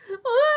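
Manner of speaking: high, loud bawling scream; short piercing tantrum shriek; toddler scream. Acted. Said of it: "Trixie bawled".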